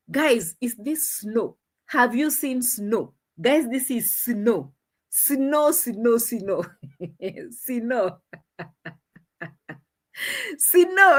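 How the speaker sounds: slow mimicry, "snow" broken into "si-no"; Nasto's repeated snow. Imitating a man asking people, slowly, "snow" as "si-no". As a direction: spell it out slowly, saying "snow" as "si-no". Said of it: "Nasto could say it again and again".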